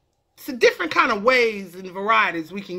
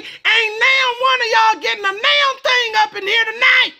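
mama scolding yell; 11 semitones higher than usual. Acted. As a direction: bark it like a fed-up mother. Mama yelling at kids.

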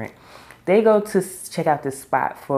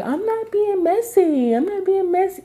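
much higher, mocking Valley girl whine. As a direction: mimic a messy castmate's Valley girl voice. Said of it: "She has that New York Valley girl talk".

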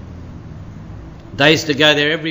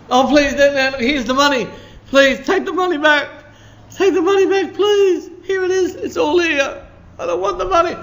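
high, desperate begging voice, much higher; pleading Judas. Acted. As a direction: beg frantically to give the money back. Preacher acts out panicked Judas.

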